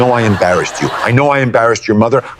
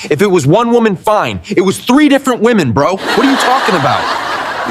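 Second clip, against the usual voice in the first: exasperated; 9 semitones higher than usual. Acted. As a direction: voice shooting high in disbelief. Son confronts cheating father.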